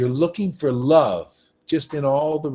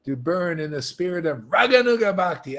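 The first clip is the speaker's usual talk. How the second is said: ordinary talk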